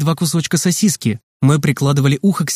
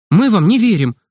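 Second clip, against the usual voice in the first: higher, firm refusal; stubborn; 6 semitones higher than usual. A narrator's reading. Boy tells the nurse they don't believe her, stubborn. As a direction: dig your heels in. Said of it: "упрямо сказал Санёк".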